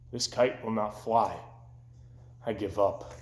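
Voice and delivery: low, soft giving up; defeated. Acted. Toad giving up to Frog, low and soft. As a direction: let it drop in defeat.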